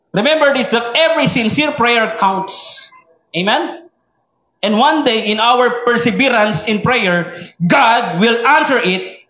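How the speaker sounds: slow, high, emphatic sermon voice; forceful preaching. Acted. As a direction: drive the promise home to the congregation. (masidhing pangangaral)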